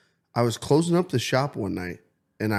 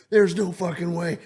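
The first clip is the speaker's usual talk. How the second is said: panicked denial; 6 semitones higher than usual. Retold or imitated. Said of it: "straight panic mode".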